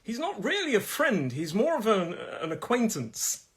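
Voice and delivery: high, lilting polite disclaimer; polite distancing. Acted. Explaining he is no close friend, politely distancing. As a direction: keep a polite distance with a high, careful lilt. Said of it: "it gives me that distance".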